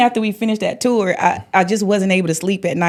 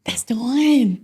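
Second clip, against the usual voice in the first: higher, drawn-out certainty; voiced realization; 4 semitones higher, slower than usual. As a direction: say it aloud, pitch lifting as you're convinced. Repeats the realization out loud.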